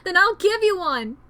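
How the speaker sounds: desperate pleading. Acted. Boy begs his dying friend to keep living, desperate shout. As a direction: shout through the despair to save him.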